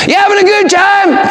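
put-on high, bright old southern man calling out. Old man greeting Fred at his table, high old-timer voice.

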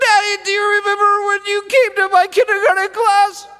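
put-on high child's voice, near tears; tearful little girl. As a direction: voice a six-year-old starting to cry. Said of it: "through tears and through sobs".

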